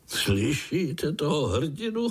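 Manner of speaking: high, slow mocking question breaking into laughter; laughing. A narrator's reading. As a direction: laugh at the little hero. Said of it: "smál se strýček". (se smíchem, posměšně)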